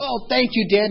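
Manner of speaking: put-on high, eager child's thanks; little boy thanking his dad, high and excited. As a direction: play the four-year-old, thrilled.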